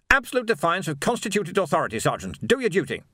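high, indignant officer barking orders; pompous officer. A narrator's reading. Captain Rust ordering his sergeant, high and indignant.

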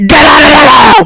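fast, high shouted order; shouting. Real, not acted. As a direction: yell it to kick him out.

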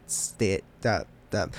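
stuck repeating the first sounds; stammering over the word; stuttering. Real, not acted. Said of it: "I am a stutterer today".

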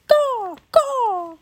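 very high imitated bird cries; raven cawing. A narrator's reading. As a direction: caw like a raven in flight. Ravens cry overhead.

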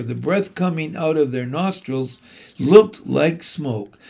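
ordinary delivery, nothing marked

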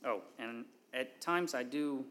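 ordinary talk, nothing marked

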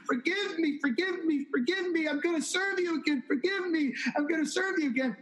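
high, pleading imitation of a penitent; retold tearful repentance. Repenting woman pleading with Jesus, retold with urgency. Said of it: "She's weeping and crying and repenting".

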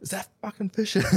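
a fan spotting his idol, voice jumping up; stunned disbelief. Retold or imitated. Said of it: "I'm like".

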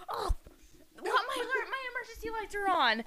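high-pitched mock panic; imitated flustered neighbor. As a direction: fret like a frantic neighbor driving. Imitates flustered swearing neighbor driving.

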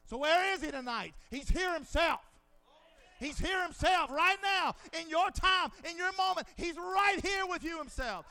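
loud, high proclaiming; preaching at full force. Real, not acted. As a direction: build it up to the congregation. Preaching build.

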